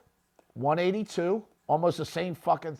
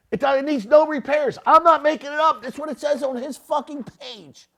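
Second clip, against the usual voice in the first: loud, high and heated, half shouting; indignant outburst; 8 semitones higher, 7 dB louder than usual. Real, not acted. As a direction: hammer it home like you're fed up. Indignant rant about guru's listing.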